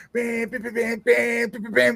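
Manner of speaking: high-pitched imitated horn beeps; car horn honking. Tourist imitating constant horns in Egypt, high honking. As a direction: honk rapidly like impatient traffic. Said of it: "use their horn over there".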